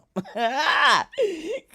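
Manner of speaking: a loud, high burst of laughter; high-pitched laugh. Real, not acted. Vlogger laughs loudly before venting about the case.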